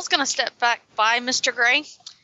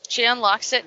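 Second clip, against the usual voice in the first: ordinary talk, nothing marked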